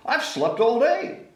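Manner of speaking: high chirpy little bird; tiny bird talking brightly; baby bird voice. A narrator's reading.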